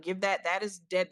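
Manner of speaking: ordinary talk, nothing marked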